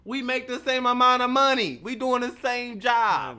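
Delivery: loud, high, indignant; heated shout. Real, not acted. Defending himself at work, loud indignant shout. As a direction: shout it like you're fed up.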